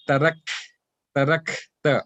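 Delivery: breathy release after the K in "tarak"; hams on kaf. Shown as a demonstration. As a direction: let air flow out of the K in "tarak", then "ta". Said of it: "hams is like".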